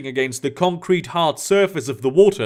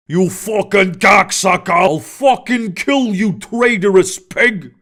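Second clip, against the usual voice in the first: furious orc roar, slow and heavy; 3 semitones higher, 4 dB louder than usual. A narrator's reading. Orc Matzo roaring curses at the traitor. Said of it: "Matzo roared".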